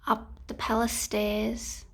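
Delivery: plain reading voice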